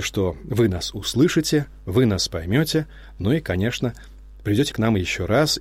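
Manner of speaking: ordinary talk, nothing marked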